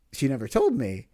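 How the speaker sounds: ordinary delivery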